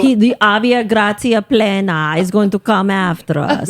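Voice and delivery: mock Italian mobster voice; hammed-up Italian accent; bad Italian accent. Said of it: "my bad Italian accent".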